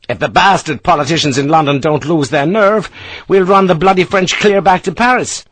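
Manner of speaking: fiery Irish boast; ebullient. A narrator's reading. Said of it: "ebullient energy".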